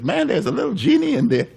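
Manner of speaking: put-on high, wide-eyed little boy voice; excited little boy. Little boy marveling at the telephone, high and excited. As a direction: marvel like a kid at the phone. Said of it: "And he says".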